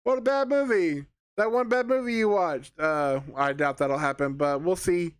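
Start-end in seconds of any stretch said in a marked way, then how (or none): 0.0-2.8 high, bright mock-cheery laugh it off; mock cheerful. Reviewer imagining future viewers, mock cheerful and high. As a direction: play it like a harmless fun flop, pitched up. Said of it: "we can just be like".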